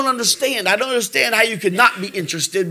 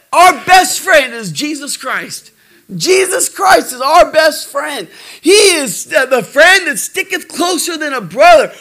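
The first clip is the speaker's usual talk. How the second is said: fervent pulpit delivery; preaching; 3 semitones higher than usual. Acted. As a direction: lean in and preach each word. Preaching about Jesus as friend.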